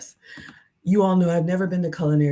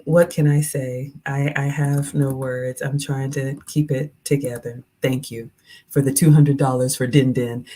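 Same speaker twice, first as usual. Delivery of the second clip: usual speaking voice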